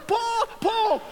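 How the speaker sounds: high, loud warning calls to his son; calling out. Retold or imitated. Hunter warning his son Paul the buck is coming, loud high calls. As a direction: yell his name across the woods to warn him. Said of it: "I'm going".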